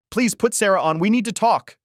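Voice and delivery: high, loud desperate plea; frightened, insistent begging; fearful pleading. A narrator's reading. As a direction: beg urgently, voice jumping up. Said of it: "hearing the fear in the other man's voice".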